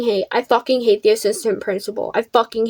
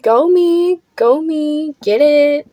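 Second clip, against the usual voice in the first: mock cheering; 3 semitones higher, much slower than usual. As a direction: cheer yourself on, playful. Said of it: "fucking cheering".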